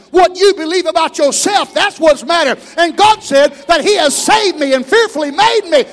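loud, building sermon cry; preaching at full force. Acted. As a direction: lift the church with it.

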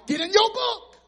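preaching shout. Real, not acted. Preacher commands congregation.

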